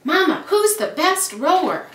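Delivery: put-on high, loud, gasping eager boy; breathless eager question. A narrator's reading. Out-of-breath boy asking his mama after rowing. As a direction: call it out between gasps for air. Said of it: "Julian gasped".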